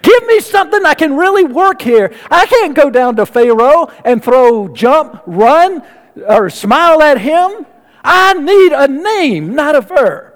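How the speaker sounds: a frustrated, half-laughing plea for a real name; exasperated Moses. Retold or imitated. Moses begs God for a name, exasperated and pitched high. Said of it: "Moses must have thought".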